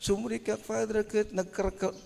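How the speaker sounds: mock-tearful girl's voice, high and soft; crying young girl. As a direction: imitate a little girl's high, plaintive complaint. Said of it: "the young girl was crying". (kunam la agsangsangit a balasang)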